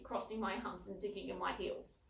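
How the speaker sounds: plain reading voice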